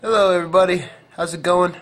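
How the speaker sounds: normal talking voice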